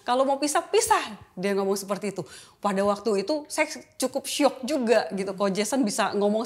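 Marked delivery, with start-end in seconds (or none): 0.0-1.2 retold angry challenge. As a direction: throw back his angry "then split". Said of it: "Dia langsung marah juga".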